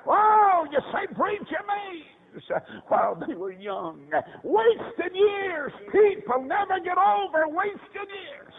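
high, ringing preacher's cry; full-force preaching. Real, not acted. Preacher warning people about wasted years, full-force preaching. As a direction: cry it out high, stretching key words.

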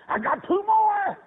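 high, loud, drawn-out preacher's cry; exultant cry. Retold or imitated. Exultant repeat of his prayer.

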